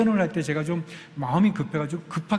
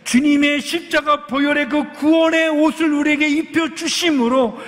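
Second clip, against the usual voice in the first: higher, louder fervent preaching; full-force preaching; 10 semitones higher, 7 dB louder than usual. Acted. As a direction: preach it out to the congregation. Preaching climax of sermon.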